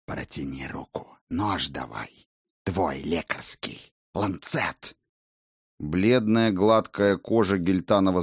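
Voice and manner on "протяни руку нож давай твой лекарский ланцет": soft, low menacing vampire. Acted. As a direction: speak as a cold, scornful vampire. Vampire voiced by narrator.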